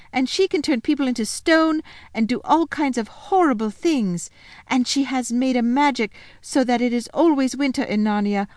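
put-on high, bright little girl voice; Lucy, happy and excited. Lucy telling Edmund about her lunch, high and excited. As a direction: chatter eagerly like a glad child.

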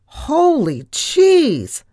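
high, loud, drawn-out exclamation; slow wail of horror; horrified cry. A narrator's reading. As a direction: cry out aghast, stretching both words. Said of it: "I cried in horror".

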